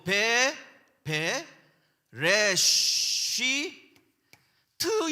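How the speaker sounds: "베, 레, 쉬, 트" drawn out one syllable at a time; syllable-by-syllable reading. Shown as a demonstration. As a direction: sound out "베, 레, 쉬, 트" with gaps between. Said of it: "이렇게 읽어요". ("베, 레, 쉬, 트"를 한 음절씩 끊어 길게 읽기)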